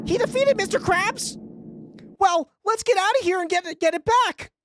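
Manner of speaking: very high, loud cartoon dub voice. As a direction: squeak it out like a cartoon villain. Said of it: "Do the live dub".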